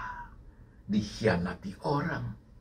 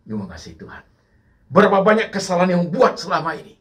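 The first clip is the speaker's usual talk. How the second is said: loud, high, urgent preaching; full-force preaching; 8 semitones higher, 9 dB louder than usual. Acted. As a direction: drive the questions home to the congregation.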